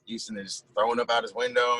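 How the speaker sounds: ordinary talk, nothing marked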